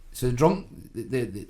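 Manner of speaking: normal talking voice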